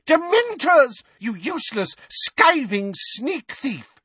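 high, furious, slow on the insult; angry old woman. A narrator's reading. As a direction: spit the insult out. Mrs Figg berates him.

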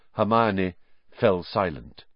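ordinary delivery, nothing marked

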